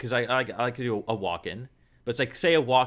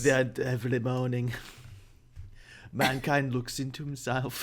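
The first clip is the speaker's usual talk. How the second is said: Werner Herzog; 7 dB quieter than usual. Acted. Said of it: "like, the Werner Herzog voice".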